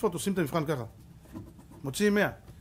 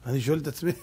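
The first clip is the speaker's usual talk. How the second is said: ordinary delivery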